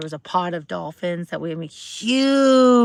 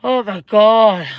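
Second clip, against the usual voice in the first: slow, loud, drawn-out dread; horrified; 10 dB louder, much slower than usual. Real, not acted. Host recalling the Titanic sub, slow horrified exclamation. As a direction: let the horror stretch out.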